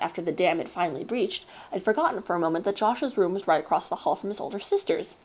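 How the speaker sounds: usual speaking voice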